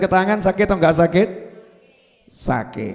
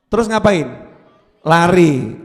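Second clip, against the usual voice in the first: ordinary delivery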